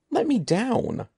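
high, panicked shriek. A narrator's reading. Evan held over the dug dirt, high shriek. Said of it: "Evan shrieked".

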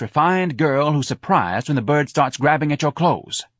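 exasperated instruction; bossy club owner. Acted. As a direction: lecture the dancer impatiently.

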